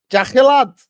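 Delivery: high loud call across the room; sudden loud high call. Real, not acted. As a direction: shout his name to get his attention. Calling out to a chat member.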